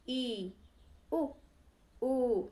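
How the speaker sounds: ई उ ऊ each said slowly and separately; close vowel drill. Shown as a demonstration. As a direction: repeat the close vowels for the class. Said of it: "तो छोटी ई, बड़ी ई".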